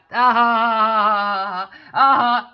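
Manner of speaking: loud, drawn-out imitation of his laugh; imitated mocking laugh. Him laughing at me as I walk, imitated laugh. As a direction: mimic him cackling in long loud "ah"s. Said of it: "laughing at me".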